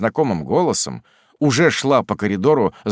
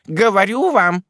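domineering aunt; 9 semitones higher than usual. A narrator's reading. Miss Betsey declaring herself godmother, domineering. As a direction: lay down the law kindly but firmly.

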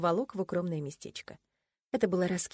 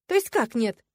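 loud, higher baffled question; taken aback; 4 semitones higher, 7 dB louder than usual. A narrator's reading. Heroine hearing the refusal, taken aback. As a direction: demand an explanation, taken aback. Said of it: "Я аж опешила".

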